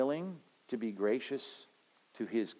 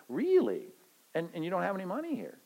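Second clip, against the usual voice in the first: higher, fast mock disbelief; mock surprise; 6 semitones higher than usual. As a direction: feign amazement, rushing on. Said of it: "voiced customer in line".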